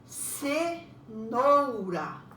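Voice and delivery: CE, NOU, RA, very slow separate beats; syllable by syllable. Shown as a demonstration. Teacher models separating syllables for pupils, cenoura very slowly in beats. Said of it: "Você vai separar a sílaba".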